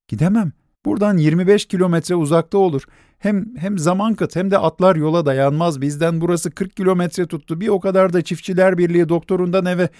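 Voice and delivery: high, fast worried argument; anxious protest. A narrator's reading. Nelli arguing against the other doctor, high and anxious. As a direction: rush the reasons.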